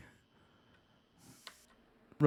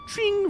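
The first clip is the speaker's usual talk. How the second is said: high, ringing trill on the word; sudden high mock phone ring; telephone ring imitation. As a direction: trill it like an old rotary phone. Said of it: "named after the sound that an old tele-, old rotary telephone makes".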